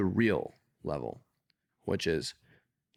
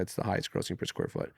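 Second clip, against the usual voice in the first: ordinary delivery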